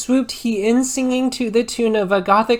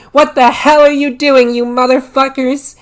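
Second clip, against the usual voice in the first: loud, higher, put-on furious shout; furious intruder; 5 semitones higher, 9 dB louder than usual. Dumbledore catching the couple, in a loud furious shout. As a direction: bellow at the pair, shocked.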